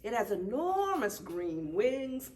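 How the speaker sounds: usual speaking voice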